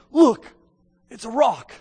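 put-on bubbling over like a small child; excited kid. Child showing dad a rock, excited and loud. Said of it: "they're just all excited".

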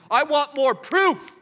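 high, loud and slow sneering demand; mocking whine. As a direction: mimic a petulant skeptic.

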